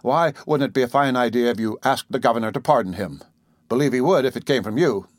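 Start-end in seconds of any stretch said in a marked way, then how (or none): none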